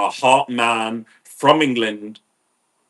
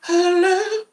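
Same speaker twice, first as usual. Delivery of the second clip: very high airy call of hello; a breathy high-pitched New Age lady calling out; woman calling up the stairs in a high wispy voice; much slower than usual. Retold or imitated. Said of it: "She go".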